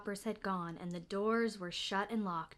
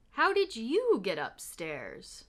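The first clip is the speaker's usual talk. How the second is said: lightly voiced watchman; 4 dB louder, slower than usual. A narrator's reading. Watchman finds the bear.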